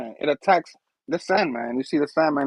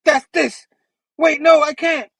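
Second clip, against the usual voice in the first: hammed-up villain, higher-pitched burst; raised voice, a mock outburst cut short; Vulture; 10 semitones higher, 5 dB louder than usual. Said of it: "And Vulture's like".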